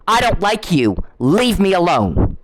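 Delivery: I don't like you and leave me alone said slowly and firmly, every word pressed; each word spelled out. Shown as a demonstration. Telling the caller to listen, slow and pointed I don't like you. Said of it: "Listen to the words".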